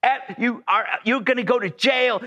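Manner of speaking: retold screaming. Woman threatens the lost driver with jail, mock screaming. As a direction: imitate an irate woman, without truly screaming. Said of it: "She's screaming and screaming".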